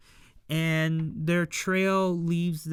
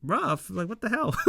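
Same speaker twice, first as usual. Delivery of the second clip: much higher, put-on scolding voice; hammed-up annoyance at being pushed; mock-offended girl snapping back, high pitched; indignant retort; 7 semitones higher, faster than usual. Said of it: "Sashika's like".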